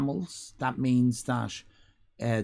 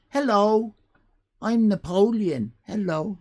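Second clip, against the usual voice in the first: high, loud silly Napoleon; hammed-up pompous emperor greeting; mock Napoleon; 8 semitones higher, 4 dB louder than usual. Streamer mocking a wrong idea of Napoleon, high and loud. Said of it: "He didn't speak like that".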